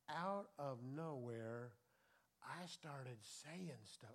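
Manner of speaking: slow, soft and low; quiet wonder, slowed right down; hushed awe. Real, not acted. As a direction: let it drop to an awed hush. Hushed recall of the Spirit.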